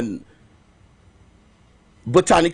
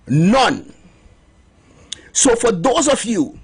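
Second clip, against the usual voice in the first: ordinary talk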